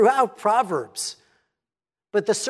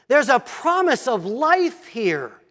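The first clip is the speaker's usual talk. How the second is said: ordinary delivery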